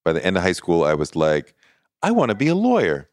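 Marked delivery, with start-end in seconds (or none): 2.0-3.1 put-on eager teen. Teen self quoted, high.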